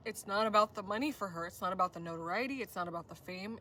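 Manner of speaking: ordinary talk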